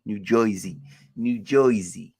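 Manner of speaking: put-on "Jersey" said as "Joisey" in New York accent. Streamer playing with where the teams play, mock New York accent.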